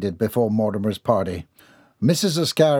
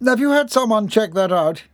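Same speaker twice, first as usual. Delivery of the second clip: high-pitched irritated question; 11 semitones higher, 4 dB louder than usual. A narrator's reading. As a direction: ask with testy annoyance. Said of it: "Neville was irritated".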